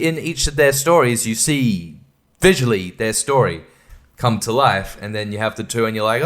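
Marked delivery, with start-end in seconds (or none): none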